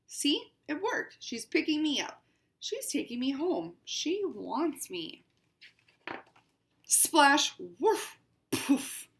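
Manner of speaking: picture book read as a proud cat; lightly voiced haughty cat; cat narrator, read aloud. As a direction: storytime reading to children.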